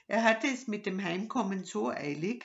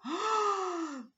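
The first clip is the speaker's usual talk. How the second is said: high startled gasp; sudden high intake of breath; sharp gasp; 14 semitones higher than usual. Acted. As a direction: gasp in surprise at what you see. Robber spots something through telescope.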